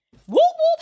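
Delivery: high, loud two-whoop police siren; imitated siren whoop. Teller imitating the police lights coming on, loud high whoops.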